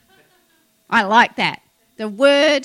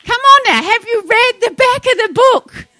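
high, loud preacher's challenge to the crowd; fired-up, high-pitched call; rousing shout; 11 semitones higher, 8 dB louder than usual. Real, not acted. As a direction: shout it out to rouse the congregation. Preacher rousing congregation, repeats question louder.

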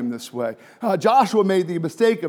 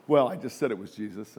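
ordinary delivery

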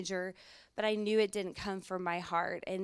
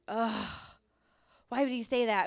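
upset groan; 2 semitones higher, 4 dB louder than usual. Retold or imitated. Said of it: "I got really upset".

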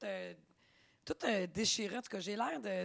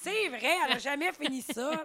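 laughing through an inner thought; half-laughing quote; 5 semitones higher, 5 dB louder than usual. Retold or imitated. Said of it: "je me suis dit".

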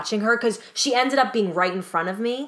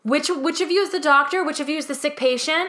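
high, pushy mock questioning; imitating a rude impatient passenger; impatient demand; 7 semitones higher than usual. Impatient woman demanding on train.

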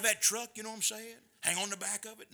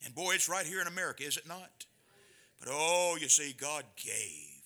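usual speaking voice

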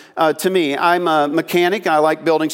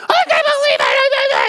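shrill outraged yell; screaming lady. Retold or imitated. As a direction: scream it like an outraged shopper in the aisle. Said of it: "this lady starts screaming at him".

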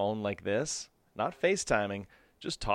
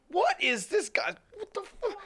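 mock driver rage, pitched up high; road rage; slower than usual. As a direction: squeal like an enraged driver. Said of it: "confrontational when I'm driving".

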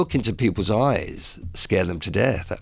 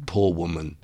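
normal talking voice

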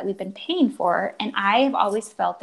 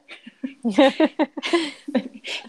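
a real burst of laughter; short amused laugh; 6 semitones higher than usual. Real, not acted. Laughing at son's question.

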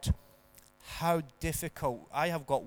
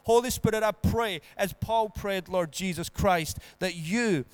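rising fervent prayer, softening at the end; 2 semitones higher, 4 dB louder than usual. Acted. Pastor praying for the congregation, fervent then hushed. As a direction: build the prayer then settle into calm.

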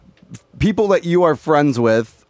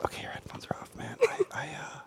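whisper; 19 dB quieter than usual. Real, not acted. Hushed aside to producer.

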